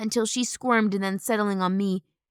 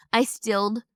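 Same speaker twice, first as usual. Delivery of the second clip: ordinary delivery, nothing marked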